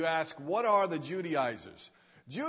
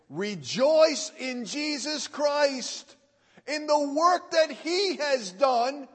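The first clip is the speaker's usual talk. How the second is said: raised, higher-pitched preacher's exhortation; fervent preaching; 5 semitones higher, 3 dB louder than usual. Real, not acted. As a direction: urge the congregation to rejoice. Builds to preaching climax.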